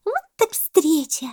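very high, loud monkey voice; excited monkey cry. A narrator's reading. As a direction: squeal with surprise at the meeting. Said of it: "закричала мартышка с пальмы". (мартышка, восторженно, громко и тонко)

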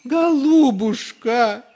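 very high, loud, slow tender plea; touched tenderness. A narrator's reading. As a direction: melt with emotion. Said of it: "умилился отец Фёдор".

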